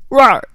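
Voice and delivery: loud, mock lion roar. Lion character roars.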